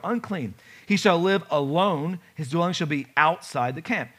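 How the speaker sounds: usual speaking voice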